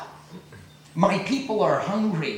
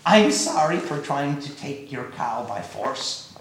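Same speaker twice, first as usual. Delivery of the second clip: humbled plea; 9 semitones lower than usual. Acted. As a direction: beg forgiveness, head bowed. Humbled king begs forgiveness.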